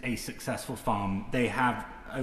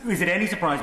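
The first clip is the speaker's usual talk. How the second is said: louder, higher, stress on is; raised emphatic question; 6 semitones higher, 6 dB louder, faster than usual. Real, not acted. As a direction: push the rhetorical question hard. Repeated rhetorical challenge.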